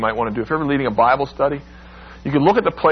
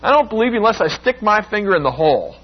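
defiant Thomas; 9 semitones higher, faster than usual. Acted. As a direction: throw the doubt back at them, high and fast. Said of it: "He's saying".

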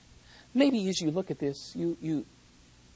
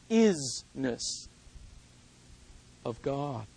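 "isness" drawn out low, savoring the coined word; leaning on "isness"; 3 semitones lower, much slower than usual. Shown as a demonstration. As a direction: dwell on "isness" as something strange and precious. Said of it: "If you would allow me to say it like this".

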